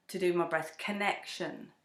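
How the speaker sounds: normal talking voice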